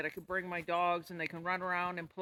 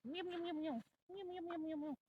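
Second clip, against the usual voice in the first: put-on talking to a baby; 11 semitones higher, 6 dB quieter than usual. Coos at the pets.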